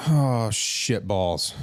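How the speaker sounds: slow, drawn-out mock dismay; sinking realization. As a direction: let it out slowly as the trouble dawns. Retold dawning dread at Ebola exposure.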